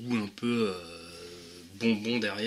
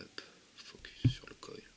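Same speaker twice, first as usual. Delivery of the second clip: whisper; 10 dB quieter than usual. Real, not acted. Muttering to camera while refilling.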